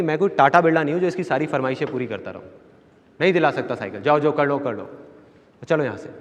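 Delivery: normal talking voice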